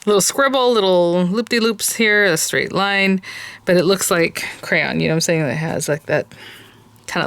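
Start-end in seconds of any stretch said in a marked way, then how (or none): none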